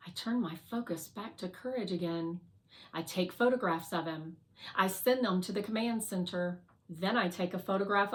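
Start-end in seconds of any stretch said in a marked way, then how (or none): none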